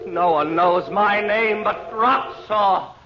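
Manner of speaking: high, slow, loud otherworldly voice; as if possessed, dragging each word out; loud, high trance voice of a possessed man; entranced answer. Acted.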